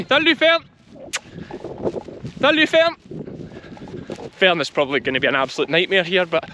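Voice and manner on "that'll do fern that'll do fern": high-pitched dog command; calling the dog. Real, not acted. Farmer to young pup, high calling command. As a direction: call the dog off, pitched right up.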